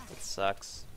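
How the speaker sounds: "That sucks" breathed out as a whisper; whispered disappointment. Real, not acted. Player let down by the play, whispering "That sucks". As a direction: mutter "That sucks" under your breath, voiceless.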